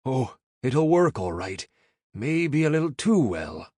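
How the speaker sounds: slow, wry Irish brogue; rueful Irish. A narrator's reading. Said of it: "with a sad shake of his head".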